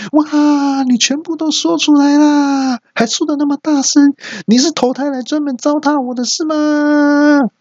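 high, shrill cartoonish exasperation; exasperated shrill voice. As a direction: squeal in disbelief at the blunder. Exasperated outburst. (尖声抓狂的吐槽)

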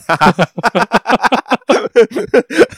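high, loud rising laugh; burst of laughter. Real, not acted. Bursts laughing at own story.

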